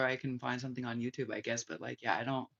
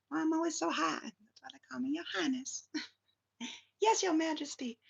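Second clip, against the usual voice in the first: much higher, playful put-on voice; mock royal voice; 14 semitones higher than usual. Joking to viewers about Your Highness, high playful voice. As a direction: squeak it out like a preening royal.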